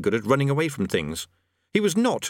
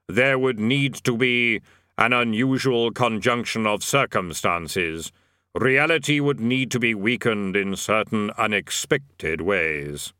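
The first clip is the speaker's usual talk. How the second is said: Death voice; slower than usual. A narrator's reading. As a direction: intone it slowly as Death. Death speaks.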